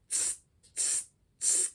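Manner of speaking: hissed unvoiced tss like a hi-hat. Shown as a demonstration. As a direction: hiss short tss like a snake. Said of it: "hard S sound".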